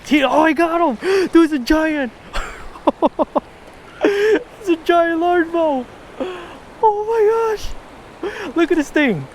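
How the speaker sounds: overjoyed, voice cracking up high; giddy excitement. Real, not acted. Angler landing a giant bass, squealing with joy.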